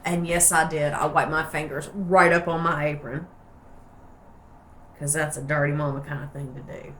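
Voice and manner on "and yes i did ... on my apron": usual speaking voice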